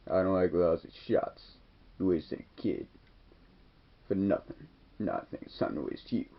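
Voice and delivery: put-on a kid doing a hushed tough-guy threat; soft deadly movie-hero menace; low menacing threat. Retelling Stallone's cold threat.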